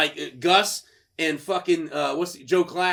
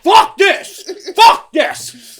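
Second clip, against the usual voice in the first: high, loud furious yell; enraged player shouting; angry shout; 11 semitones higher, 12 dB louder, much slower than usual. Retold or imitated. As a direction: scream it as you rip the flag. Player ripping the flag.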